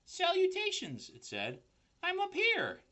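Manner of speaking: very high, tiny spider voice; little spider calling down; squeaky small voice. A narrator's reading. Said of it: "a small voice".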